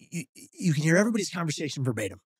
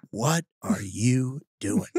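slow, word-by-word disbelief; imitating a stunned, deliberate demand; appalled question; much slower than usual. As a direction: space out each word, as if appalled. Date's appalled question, acted.

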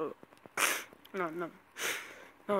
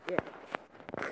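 sneezing; 5 dB quieter than usual. Real, not acted. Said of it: "Sorry for my sneezing".

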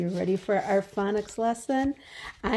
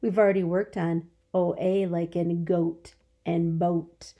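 normal talking voice

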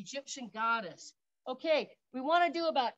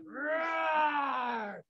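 lion roar. Shown as a demonstration. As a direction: roar out like a big cat. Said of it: "she might even roar".

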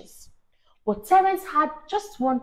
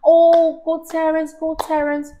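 cheering crowd; 5 semitones higher, 5 dB louder than usual. Acted. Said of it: "clap for him and say".

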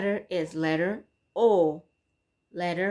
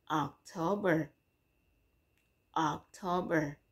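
soft demo word; 3 semitones lower, 6 dB quieter than usual. Shown as a demonstration. Teacher repeating vocabulary for learners, softer. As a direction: say it softly for the kids to copy. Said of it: "as in".

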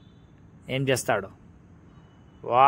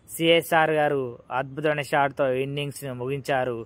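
ordinary talk

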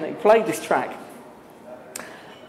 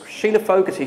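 ordinary delivery

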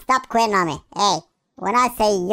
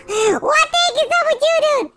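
high-pitched, incredulous complaint; mock outrage; 3 dB louder than usual. Player to the guard standing there staring, high mock outrage. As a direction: scold in a squeaky high voice. Scolding an idle guard in game.

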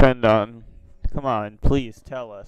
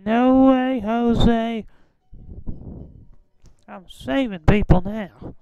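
much higher put-on old man voice; Herbert voice; 9 semitones higher, slower than usual. Teasing strangers on video chat in high old man voice. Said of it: "a glimpse of my Herbert voice".

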